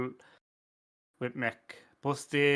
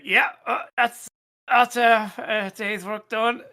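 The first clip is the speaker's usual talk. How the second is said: higher, slow and satisfied, drawn out; smug slacker; 7 semitones higher, 6 dB louder, much slower than usual. Retold or imitated. Coder answers the manager with a slow, smug, higher drawl. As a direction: stretch it out like the day's work is done.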